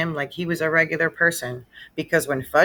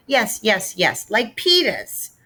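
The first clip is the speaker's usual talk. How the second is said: put-on slow, higher toddler insisting; hollering; 5 semitones higher, slower than usual. Fudge insisting to Mister Berman, mild toddler holler. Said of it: "Fudge hollered".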